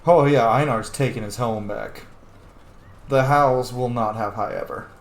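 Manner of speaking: ordinary talk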